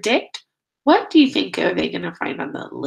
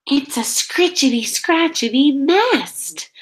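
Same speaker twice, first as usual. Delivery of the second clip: higher, delighted, slowing on scratchety; surprised reveal; 5 semitones higher than usual. Acted. Teacher revealing the nest to her class, playful surprise. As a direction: savour the funny words.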